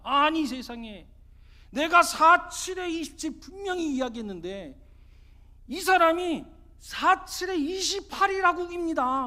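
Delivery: high, loud, drawn-out protest; indignant complaint. Acted. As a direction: plead your case stubbornly to the magistrate. 고집센 사람이 사또에게 항변. (사또에게 억울한 듯 크게 항변)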